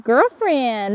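ordinary delivery

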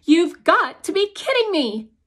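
loud, high disbelieving cry; a mother's stunned, loud protest; shocked outburst. A narrator's reading. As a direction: burst out in furious disbelief. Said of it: "in a voice I've never heard".

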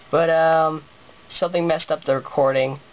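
ordinary talk, nothing marked

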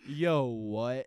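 loud, incredulous reaction, played up; stunned disbelief. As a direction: blurt it in mock shock. Acts own stunned reaction.

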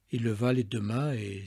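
ordinary delivery

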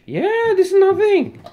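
very high mocking yeah; mocking exclamation. Real, not acted. Mocking his brother's small pile of cheese, pitched high. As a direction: scoff at him, jumping up high.

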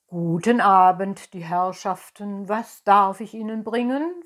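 lightly voiced, loud innkeeper; innkeeper's greeting. A narrator's reading. Innkeeper greets new guests at table, loud and lightly characterised. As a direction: greet the guests as a hint of a hearty host.

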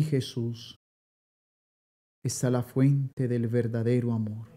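usual speaking voice

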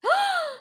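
sharp high gasp; shocked gasp. Real, not acted. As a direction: gasp in outrage. Offended by flat butt remark.

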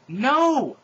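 high, very loud defiant shout. Acted. Heroine seeing Voldemort in the air, very loud shout. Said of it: "I shouted".

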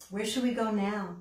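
ordinary delivery

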